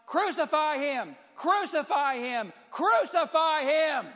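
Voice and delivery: loud, high mob chant, drawn out; shouting out. A narrator's reading. Crowd demanding crucifixion from Pilate, full shouting. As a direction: shout the demand, building to a long last cry. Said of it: "they kept shouting out".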